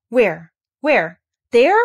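loud single-word bursts; urgent, thrilled questions with pauses between; excited cry. A narrator's reading. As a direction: cry out each word eagerly. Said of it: "he cried".

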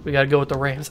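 normal talking voice

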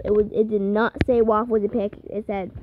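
normal talking voice

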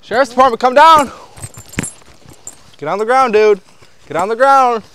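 shouting commands. Real, not acted. Police ordering suspect down.

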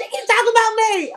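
high whiny tattletale; whiny complaining voice. Retold or imitated. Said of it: "They were like".

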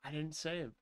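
soft, stunned realization; quiet dismay. Real, not acted. Realizes the game wasn't saved.

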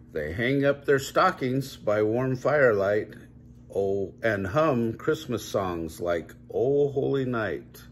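lively, singsong picture-book reading; reading to children. Acted. Parent reading a Christmas bear book to child, lively storytelling. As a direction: read it brightly for a little one.

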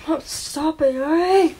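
normal talking voice

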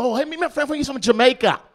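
high, loud, careless intro; loud friendly introduction. Retold or imitated. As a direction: call it out to the room. Friend introducing him wrongly.

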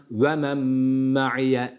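ve men ma'iye very slow, n merged into m; held nasal men ma'iye; nasal ghunna. Shown as a demonstration. Tutor demonstrates the ghunna slowly. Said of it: "sakin nunu mime katıyoruz".

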